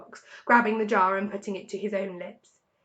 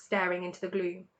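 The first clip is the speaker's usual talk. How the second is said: plain reading voice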